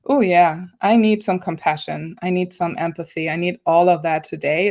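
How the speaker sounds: usual speaking voice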